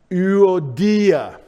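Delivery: Euodia loud and drawn out, every vowel sounded; careful Greek reading. Shown as a demonstration. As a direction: say Euodia slowly as oo-oh-DEE-ah. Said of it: "We read it Euodia".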